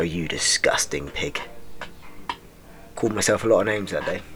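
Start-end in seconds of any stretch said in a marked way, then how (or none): 0.0-1.4 low, slow disgusted sneer; self-insult. Retold or imitated. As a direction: sneer at yourself in the mirror. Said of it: "just so I could go".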